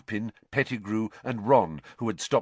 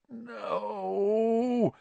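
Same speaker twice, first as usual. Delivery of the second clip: long, high anguished moan; 12 semitones higher than usual. A narrator's reading. Sirius cowers on all fours, moaning. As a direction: moan it out helplessly. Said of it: "he moaned".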